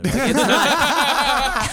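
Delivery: breaking into high laughter; words lost in a laugh; laughing hard while talking. Real, not acted.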